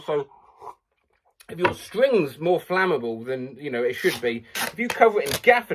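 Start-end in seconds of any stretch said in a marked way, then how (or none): none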